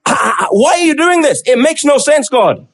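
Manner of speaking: acted disbelief, stammering into protest; incredulous. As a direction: plead with God for an explanation. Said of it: "hear the incredulity in his voice".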